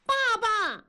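put-on very high child's cry for Dad; loud calling. A narrator's reading. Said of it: "燕妮大声地呼叫". (大声呼叫)

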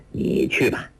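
low chuckle leading into the words; laughing. A narrator's reading. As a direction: chuckle indulgently, voice dropped low. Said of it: "王处一笑道".

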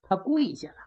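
usual speaking voice

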